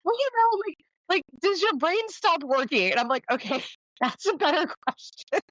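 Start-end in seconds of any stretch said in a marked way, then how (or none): 0.0-2.9 high mock naive voice. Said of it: "And she goes". 3.3-5.4 laughing through the words, pitched higher; a giggly amused reply. Real, not acted. Laughing at a woman's odd question about her brain.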